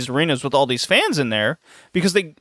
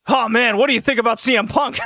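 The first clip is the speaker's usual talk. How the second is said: higher, fast excited fan voice; eager stranger voice; 7 semitones higher than usual. Retold or imitated. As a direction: play a pushy wrestling fan cornering a shopper. Imitating eager stocker.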